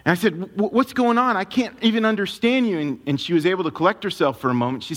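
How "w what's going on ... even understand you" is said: higher-pitched, urgent questioning; mildly alarmed, voice pitched up; worried husband retelling, pitched up; retold alarm. Retold alarmed reply to crying wife.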